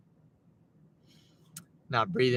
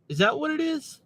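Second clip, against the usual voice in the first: surprised question; 12 semitones higher than usual. Real, not acted. Reacting to a chat comment.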